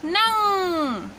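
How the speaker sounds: नंग stretched very slowly, nasal ng held; slow teaching demo. Shown as a demonstration. Teacher showing students how to say नंग, very slow. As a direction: draw out नंग for learners. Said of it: "ये मिलके बनाएगा".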